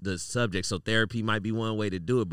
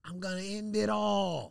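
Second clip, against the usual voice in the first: dramatic wail; 8 semitones higher, 4 dB quieter than usual. Retold or imitated. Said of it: "He goes".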